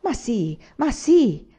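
happy, slightly teasing yes, lifting on the repeat; Margherita. A narrator's reading. Anania mimics Margherita to reassure the old woman, teasing. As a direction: imitate a cheerful, lightly mocking girl. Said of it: "imitando Margherita nel pronunziare quel sì lieto e un po' canzonatore".

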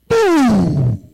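loud high boom; dramatic boom. Real, not acted. Preacher marking the spirit's blow, loud. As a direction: slam it out.

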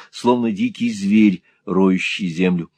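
ordinary delivery, nothing marked

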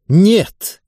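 a high, stinging no; sharp sarcastic no. A narrator's reading. Natalya answering her mother, venomously sarcastic. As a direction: sneer the word out. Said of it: "язвительно отозвалась Наталья".

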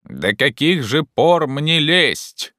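slow, high, drawn-out holler; distant call. A narrator's reading. Jupiter asks from high in the tree, calling from afar. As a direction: call down as if from very far off. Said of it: "совсем издали послышался его голос".

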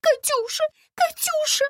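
very high frantic call; shrill-pitched alarmed shout; panicked calling. A narrator's reading. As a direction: call out desperately for someone missing. В панике зовёт Катюшу.

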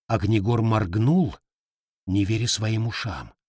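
usual speaking voice

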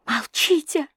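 high, urgent plea for silence; desperate cry. A narrator's reading. Girl rushes to silence the husband, desperate cry. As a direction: cry out high to stop him. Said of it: "закричала я, бросаясь вперёд".

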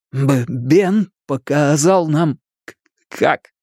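slow, halting stammer; stretched, effortful stuttered answer; stuttering boy. A narrator's reading. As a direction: catch on the first sounds.

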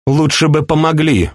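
high-pitched, reproachful plea; near tears. A narrator's reading. Princess pleading with her uncle, near tears. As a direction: beg for help with a touch of tears. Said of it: "Глаза её повлажнели".

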